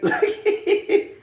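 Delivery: high-pitched cackling laugh; high cackle. Real, not acted. Laughing at a troll in his chat, high cackle.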